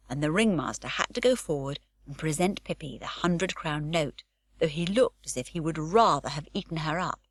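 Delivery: plain reading voice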